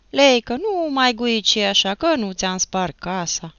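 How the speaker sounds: ordinary delivery, nothing marked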